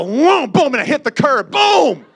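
loud, high booming crash; crash imitation. Storyteller acting out hitting the curb, loud crash imitation. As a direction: explode on each boom, biggest on the last.

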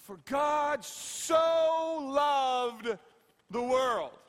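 preacher dwelling on every word; emphatic drawn-out verse. Acted. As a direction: hold and stretch "so", lean hard on the verse. Preacher slowly repeats key verse.

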